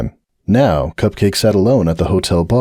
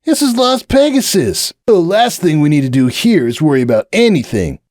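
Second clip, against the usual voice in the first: higher, brash party colt; cocky Hoops; 8 semitones higher than usual. Acted. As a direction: wave off the warning. Hoops brushes him off.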